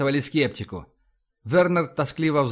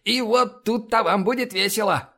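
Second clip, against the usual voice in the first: put-on higher voice, warning the room; Borovsky imitation; 6 semitones higher than usual. A narrator's reading. Linda warning the crew that the first mate is coming, Borovsky imitation. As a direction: impersonate someone announcing trouble. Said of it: "продолжила Линда голосом Боровского".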